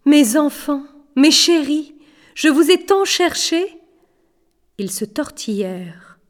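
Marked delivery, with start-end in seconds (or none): 0.1-3.7 high, loud, tearful outpouring; sobbing. A narrator's reading. Mother embraces her long-lost children, sobbing. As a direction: cry it out phrase by phrase, rising.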